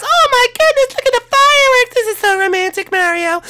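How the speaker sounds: very high, squealing with joy; thrilled high princess voice. Acted. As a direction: shriek yes like an overjoyed princess. Daisy watches the fireworks.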